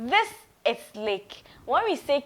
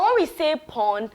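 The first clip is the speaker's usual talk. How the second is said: normal talking voice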